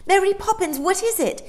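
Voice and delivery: put-on high, loud child demanding an answer; demanding girl. A narrator's reading. Jane demands an answer from Mary Poppins, high and insistent. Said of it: "demanded Jane".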